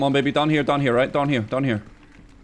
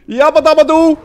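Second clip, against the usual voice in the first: Fred Flintstone yell; 9 semitones higher, 8 dB louder than usual. Pretend. Cartoon catchphrase yelled.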